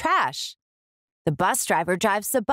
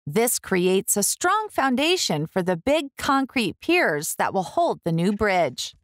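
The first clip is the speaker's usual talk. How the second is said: plain reading voice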